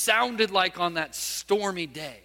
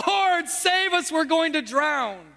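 disciples crying out; 6 semitones higher, slower than usual. Retold or imitated. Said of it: "the disciples cried out".

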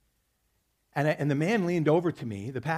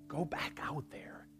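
slow, breathy whisper of God's voice; a slow hushed whisper of God's urging; still small voice; 12 dB quieter, slower than usual. Retold or imitated. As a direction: whisper it like a gentle inner voice. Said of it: "in that still small voice".

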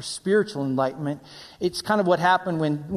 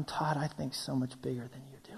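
low, whispery calm answer; quiet God; 5 semitones lower, 13 dB quieter than usual. Acted. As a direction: answer softly from above the uproar. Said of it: "God's going".

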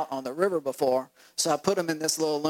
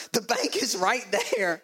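exasperated parent; 4 semitones higher than usual. Retold or imitated. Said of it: "And I'm like".

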